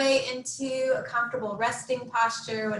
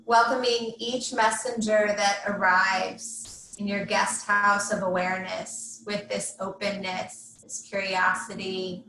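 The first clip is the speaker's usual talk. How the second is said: measured meditation guide, long pauses between phrases; guided meditation. Real, not acted. Yoga teacher settling students into Yoga Nidra, guided meditation. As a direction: guide them gently into rest, leaving space after each instruction.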